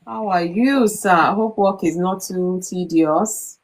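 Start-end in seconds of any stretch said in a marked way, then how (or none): none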